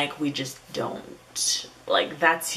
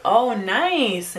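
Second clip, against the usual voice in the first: high, louder, stretched-out reaction; feigned interest; 6 semitones higher, 5 dB louder than usual. Retold or imitated. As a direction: play along with put-on interest. Acts out noncommittal reply to offer.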